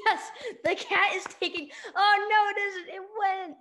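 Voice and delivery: high, loud and giddy; excited shrieking. Pretend. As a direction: yell it out between laughs. Excited game commentary.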